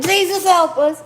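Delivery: hammed-up frantic prayer; desperate plea. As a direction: cry out to Jesus like you're in trouble. Desperate plea to Jesus acted.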